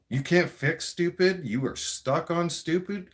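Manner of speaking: each word slowed and pressed; slow emphatic jab. Real, not acted. Jabbing at Trudeau, slow and emphatic. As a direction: spell it out slowly like a verdict.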